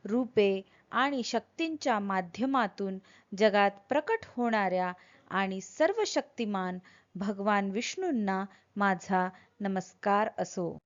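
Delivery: ordinary talk, nothing marked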